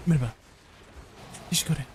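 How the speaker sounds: quick throwaway Merhaba, Teşekkür with the sounds run together; fast, not enunciated. Shown as a demonstration. Streamer dodging Turkish pronunciation by speed, fast and unenunciated. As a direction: rush Merhaba and Teşekkür, slurring the syllables together. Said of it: "If I say it fast enough, then I don't have to enunciate".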